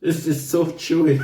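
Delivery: laughing. Real, not acted. Laughing while chewing tough beef.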